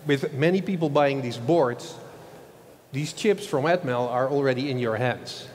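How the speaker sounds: normal talking voice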